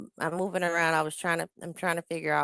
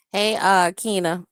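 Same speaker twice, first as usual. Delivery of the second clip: normal talking voice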